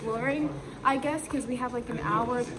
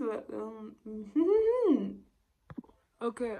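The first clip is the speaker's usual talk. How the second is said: usual speaking voice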